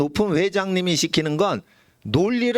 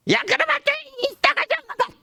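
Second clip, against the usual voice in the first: scolding yell. Acted. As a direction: yell at the kid to stop. Parent scolding child, acted. (아이를 꾸짖는 높고 큰 외침)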